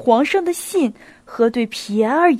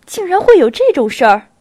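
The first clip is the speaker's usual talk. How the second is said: louder, spiteful sneer with a smile; 4 semitones higher, 6 dB louder than usual. A narrator's reading. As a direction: sneer it, half laughing. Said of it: "恶意地微笑着".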